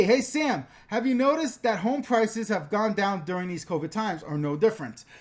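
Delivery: normal talking voice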